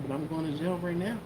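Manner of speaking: ordinary delivery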